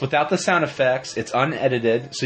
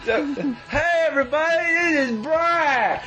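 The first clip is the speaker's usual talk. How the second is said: silly cartoon voice; 9 semitones higher than usual.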